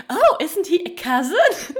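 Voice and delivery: high, loud mock-innocent question; hammed-up imitation on the edge of laughter; mock exposition line. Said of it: "they say".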